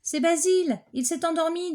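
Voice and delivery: put-on high, bright child tattling; a girl pointing out the sleeper; little girl voice. Léa tells the teacher Basile fell asleep, high child voice.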